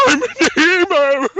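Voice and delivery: high, loud laughing squeal; laughing mock cry. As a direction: cackle out emo like a joke. Mocking the emo character.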